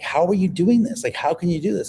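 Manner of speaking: retold shock and awe. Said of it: "the shock and awe".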